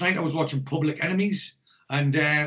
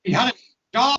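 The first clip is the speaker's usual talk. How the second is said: excited; 9 semitones higher, 7 dB louder than usual. Real, not acted. Excited retelling of a movie line.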